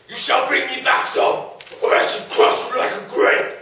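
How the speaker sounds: loud rasping monster voice; booming frost giant. Acted. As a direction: threaten like a giant brute. Monster threatens hero.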